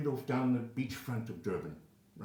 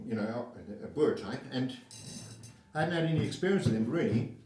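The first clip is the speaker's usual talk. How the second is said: normal talking voice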